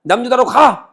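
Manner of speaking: loud, high angry order to leave; mock shout to get out; angry expulsion. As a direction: drive the prophet out. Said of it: "그러면서 아모스한테".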